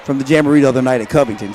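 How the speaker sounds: normal talking voice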